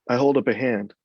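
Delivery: plain reading voice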